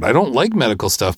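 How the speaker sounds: normal talking voice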